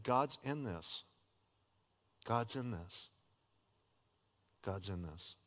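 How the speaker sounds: fading hush. Real, not acted. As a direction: let it die into a whisper of faith. Repeated, fading.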